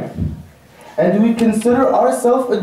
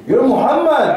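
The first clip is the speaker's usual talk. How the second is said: higher, emphatic proud declaration; 4 semitones higher than usual. Shown as a demonstration. Preacher rousing a timid brother about his name, proudly. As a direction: proclaim the name with confidence. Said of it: "And say it with pride".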